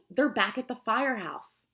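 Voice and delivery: ordinary delivery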